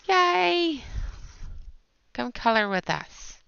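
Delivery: high, loud drawn-out yay; cheer. Real, not acted. Cheering viewers on to color along, a high cheer.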